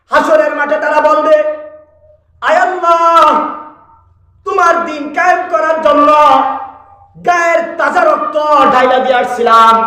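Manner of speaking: high-pitched, forceful sermon delivery; impassioned preaching. Real, not acted. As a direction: preach it out in a high, ringing declamation. (উঁচু সুরে জোরালো ওয়াজ)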